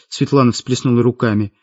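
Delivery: ordinary delivery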